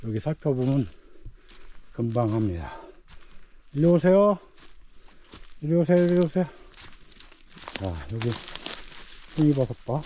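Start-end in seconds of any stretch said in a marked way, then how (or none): 3.7-6.4 loud, high call to a dog. Real, not acted. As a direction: summon the dog across the hill. Calling the dog over.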